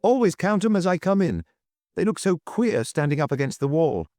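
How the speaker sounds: high, eager cry; young duke's cry. A narrator's reading. Young duke confirming the waiters are here, excited. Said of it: "cried the young duke".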